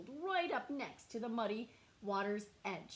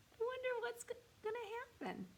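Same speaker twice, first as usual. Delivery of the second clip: curious wondering; 14 semitones higher, faster than usual. Real, not acted. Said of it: "teacher wonders aloud".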